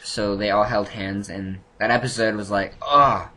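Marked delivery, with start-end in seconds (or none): 2.9-3.3 a loud, higher groan as the blast hits; mock groan. Viewer groans at the dragon blast, a loud mock groan. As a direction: groan like the island just got hit.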